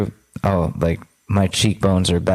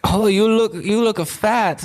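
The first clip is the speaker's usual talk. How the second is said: put-on much higher, slow, blunt old-lady voice; Korean grandmother; 12 semitones higher than usual. Grandmother greeting the uncle at Thanksgiving, high and blunt. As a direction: deliver it like a frank grandma. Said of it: "my Korean grandmother... She's like".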